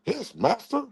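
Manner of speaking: lightly voiced meek servant; a hint of a cowed pet answering; mock servant. Streamer playing his rival as an obedient pet, meekly.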